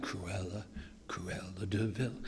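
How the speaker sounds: slow, hushed villainous whisper; sinister whisper. Acted. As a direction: whisper the name slowly, with menace. Savouring the villainess's sinister name.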